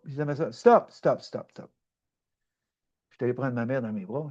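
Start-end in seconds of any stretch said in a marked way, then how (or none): 0.5-1.2 louder, commanding retold stop; forceful stop, stop; firm stop. Retold: stopping his sister. 1.2-1.7 dropped to a breathy whisper; soft whispered repeat; whispered stop. Retold or imitated. Retold: stopping his sister.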